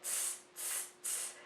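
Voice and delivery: whispered "tss" hisses; monkey tss. Acted. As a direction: hiss "tss" like teasing monkeys. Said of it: "the monkeys respond also".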